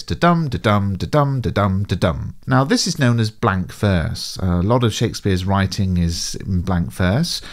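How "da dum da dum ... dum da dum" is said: da-dum da-dum, weak then strong beat; iambic da-dum rhythm. Shown as a demonstration. Teacher demonstrating the iambic rhythm by chanting. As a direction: chant the iamb beat evenly. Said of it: "they sound like this".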